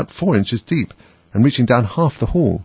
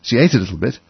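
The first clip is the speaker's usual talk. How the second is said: ordinary delivery, nothing marked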